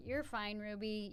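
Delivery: very quiet, soothing mom voice, acted out; soft reassurance. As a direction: hush an upset child gently. Acts out soothing her upset daughter.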